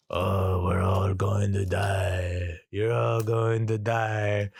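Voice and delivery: put-on slow, drawn-out drunken droning; drunken wail. Dimitri drunkenly wailing doom into the intercom, slow and slurred. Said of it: "drunk as fuck".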